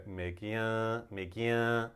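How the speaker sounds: "物件" drawn out slowly with a nasal ending. Shown as a demonstration. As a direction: send the end of "物件" through the nose, Taiwanese style. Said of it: "It's very nasal".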